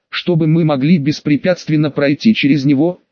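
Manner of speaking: usual speaking voice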